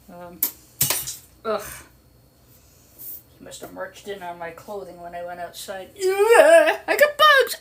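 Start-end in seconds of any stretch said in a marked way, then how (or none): 6.0-7.6 high, loud squeal, half laughing half crying; giggling wail of disgust at a bug; laughing shriek. Real, not acted. Bug found on her clothes.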